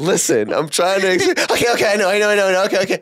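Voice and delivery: rising, flustered pleading; exasperated pleading. Retold or imitated. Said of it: "our conversations are mainly, like".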